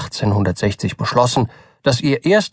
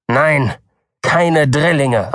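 slow, cutting reply; 3 semitones higher, much slower than usual. A narrator's reading. The doctor answers Button sharply, cutting and slow. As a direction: bite off each word. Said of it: "antwortete der Doktor schneidend".

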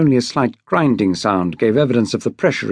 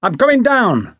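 much higher, calling down; 9 semitones higher than usual. A narrator's reading. Mate calling down before climbing the ladder, calling out. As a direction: announce yourself to the boat below. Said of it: "Mister Brett shouted".

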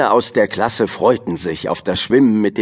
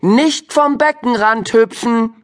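stern teacher; 11 semitones higher than usual. A narrator's reading. Teacher warning Jo and Juri, high and stern. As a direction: lay down the law, stressing nicht. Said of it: "warf Jo und Juri einen strengen Blick zu".

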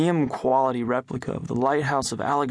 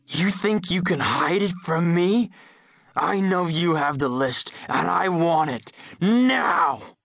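strangled roar; 4 semitones higher than usual. A narrator's reading. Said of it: "a deep, strangled voice roar".

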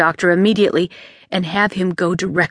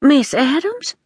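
maid on the phone; 7 semitones higher, slower than usual. A narrator's reading. Maid's voice on the phone.

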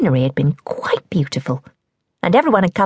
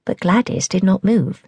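ordinary delivery, nothing marked

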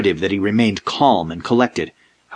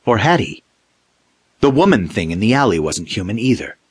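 ordinary delivery, nothing marked

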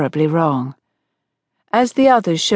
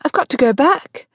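high-pitched, alarmed outburst; gasped; 8 semitones higher than usual. A narrator's reading. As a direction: blurt it out in rising alarm. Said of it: "she gasped".

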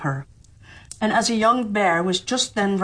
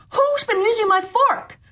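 much higher, squeaky, louder dwarf; fifth dwarf; 3 dB louder than usual. Acted. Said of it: "And the fifth".

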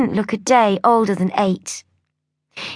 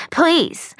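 Please pitched up high, begging; pleading please; 6 semitones higher than usual. A narrator's reading. Fan begging the bakery for a cake, pleading please. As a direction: beg on the word Please. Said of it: "Dear wonderful Rose".

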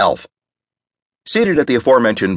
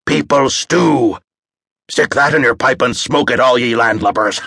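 pirate yarn; 4 semitones higher than usual. A narrator's reading. Pirate yarn told by Connor.

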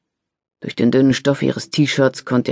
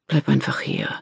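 soft coaxing; 6 dB quieter than usual. Acted. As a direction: coax her back to bed. Husband coaxes in bed.

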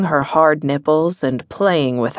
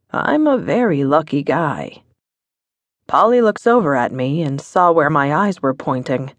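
ordinary delivery

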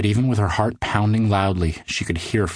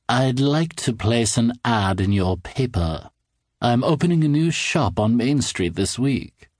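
slow, higher, strange unsettling voice; creepy odd man; 4 semitones higher, slower than usual. A narrator's reading. Odd man asking the cartoonist to place an ad, creepy and smug. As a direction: speak with a fixed unsettling smile.

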